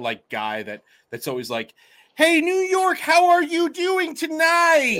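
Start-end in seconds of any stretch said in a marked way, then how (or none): 2.2-5.0 much higher, louder, slow and stiff frontman greeting; hammed-up arena frontman hollering to the crowd; stiff singer stage banter. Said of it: "It's, like, super stiff".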